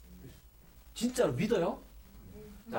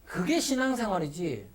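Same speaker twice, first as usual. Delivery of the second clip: usual speaking voice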